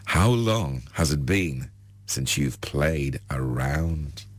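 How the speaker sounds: slow, low, teasing; seductive. Acted. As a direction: draw it out seductively. Seductive blonde question.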